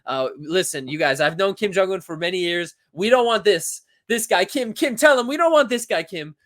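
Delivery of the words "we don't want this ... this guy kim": high, loud hammed-up plea; over-the-top appeal to the dictator; mock pleading.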